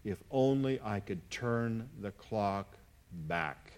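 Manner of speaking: slow, softly retold regret; tearful regret, retold. Preacher quoting heartbroken people, understated tearful regret. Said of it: "with tears running down their face".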